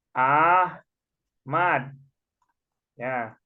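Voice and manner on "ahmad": "Ahmad" split as Ah, pause, mad; syllable-by-syllable. Shown as a demonstration. Teacher spells out Mas Ahmad's name, syllable-by-syllable. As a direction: sound out "Ahmad" slowly in two louder syllables. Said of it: "nulisnya begini".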